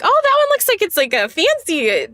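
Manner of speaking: high excited voice. Retold or imitated. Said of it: "and I'm like".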